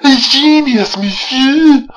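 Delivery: put-on very high, loud phony French accent; mock French accent. Reviewer mimicking the actress's line, hammed-up French accent. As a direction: squawk it in an overblown French accent. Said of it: "with that phony French accent".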